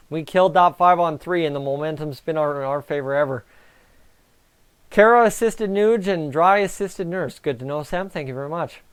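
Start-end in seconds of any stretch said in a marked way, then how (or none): none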